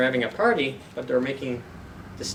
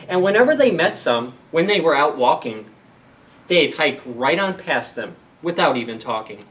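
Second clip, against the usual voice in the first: louder, bouncy rhyming read-aloud; lively picture-book narration; storybook reading; 2 semitones higher, 6 dB louder than usual. Acted. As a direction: play up the rhyme for the kids. Reads Dr. Seuss aloud to kids.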